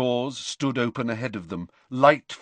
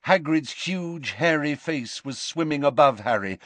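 ordinary delivery

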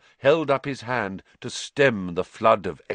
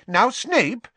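startled; 5 dB louder than usual. Acted. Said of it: "said Fudge, startled".

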